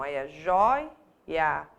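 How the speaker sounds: joia drawn out very slowly and low; slow demo. Shown as a demonstration. Teacher stressing joia to show it lost its accent. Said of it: "o joia não".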